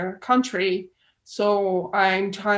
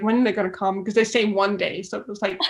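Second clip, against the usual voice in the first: ordinary talk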